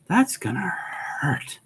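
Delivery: awed whisper, pitch lifted. Real, not acted. Player sees twelve attacks on Ghazghkull: awed whisper. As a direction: whisper it, impressed and a little wary.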